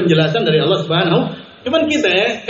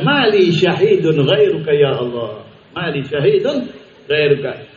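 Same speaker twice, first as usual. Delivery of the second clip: slow, lower, hushed supplication; hushed prayer; 5 semitones lower than usual. Retold or imitated. Preacher voicing the slandered man praying alone, slow and hushed. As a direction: murmur it as a humble plea to God.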